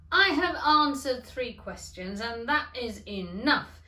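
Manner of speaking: slow, loud, fed up; firm old father. A narrator's reading. Father ending his son's questions, slow and loud. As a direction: lay down the law, slowly. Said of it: "said his father".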